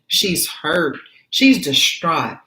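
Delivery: normal talking voice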